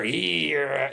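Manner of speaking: imitated growl rising into a second one; layered growl. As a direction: growl on top of a growl. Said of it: "a growl on top of a growl".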